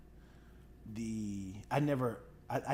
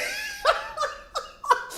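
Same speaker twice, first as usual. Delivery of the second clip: high laughter; 5 dB louder than usual. Real, not acted. Retelling a funny moment.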